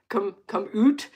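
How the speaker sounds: "oot" said with a round Canadian oo. Shown as a demonstration. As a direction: stretch "oot" in an Alberta accent. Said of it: "my Alberta accent".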